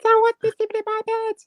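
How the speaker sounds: put-on very high squeaky baby bear voice. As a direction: squeak it like a tiny bear cub. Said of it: "Shall I do it again".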